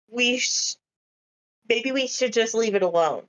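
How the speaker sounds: ordinary talk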